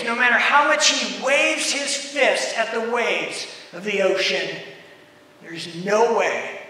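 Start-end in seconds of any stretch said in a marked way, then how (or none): none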